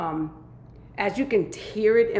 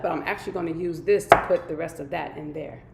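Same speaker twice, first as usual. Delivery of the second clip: usual speaking voice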